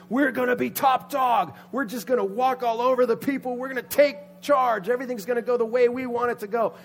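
high, loud, cocky rallying talk; acted excited confidence; great expectation. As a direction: boast like fans sure of winning. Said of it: "started with great expectation".

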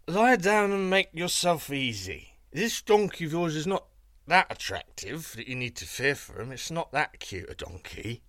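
lightly voiced tipsy guards slurring reassurance; drunken sentinels. A narrator's reading. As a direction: sentinels drunkenly dismiss the donkey. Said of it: "said the sentinels drunkenly".

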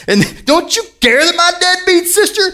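high-pitched, hammed-up indignant accusation; accusing Martha. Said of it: "Martha's beating him up".

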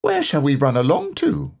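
curious little Roo asking; lightly voiced Roo. A narrator's reading.

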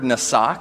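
nasa said as nə-SAH, stress on the second syllable; Hebrew word nasa. Shown as a demonstration. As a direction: sound out nasa slowly for the people to repeat. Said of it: "Can you say nasa?".

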